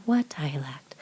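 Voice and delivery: whispered, sugary imitation of her; a wide-eyed whisper, playing innocent; soft and sweet. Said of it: "Talking real sort and s-, soft and sweet".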